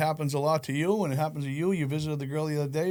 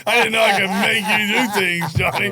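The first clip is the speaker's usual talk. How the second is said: higher, louder laughing tease; 6 semitones higher, 8 dB louder than usual. Real, not acted. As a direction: tease him through laughter. Teasing Johnny while laughing.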